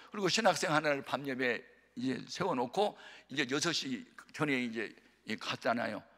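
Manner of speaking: ordinary talk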